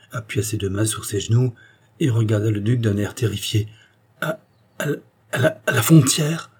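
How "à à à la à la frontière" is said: terrified stammer. A narrator's reading. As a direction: stumble over the words in panicked disbelief. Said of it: "balbutia-t-il". (balbutiement terrifié, la voix monte haut et fort sur frontière)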